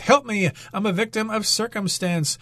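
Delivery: pleading in a high voice; half-hearted cry for help; high mock plea. Acted plea as example.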